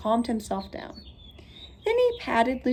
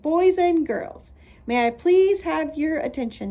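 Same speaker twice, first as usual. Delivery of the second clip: calling for attention; 7 semitones higher, 5 dB louder than usual. A narrator's reading. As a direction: call the room to order. Father addresses party crowd.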